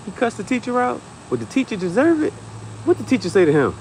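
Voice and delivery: high, eager mock-proud questions; hyping up. Parent hyping kid after suspension. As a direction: side with the kid, eager to hear. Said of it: "I'm gonna be hyping my kids up".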